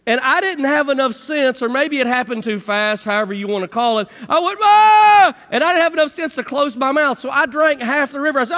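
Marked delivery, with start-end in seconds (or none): 4.6-5.4 loud high yelp, much higher and louder; sudden startled scream; retold scream. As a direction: cry out as the tube flips. Flipped into the river.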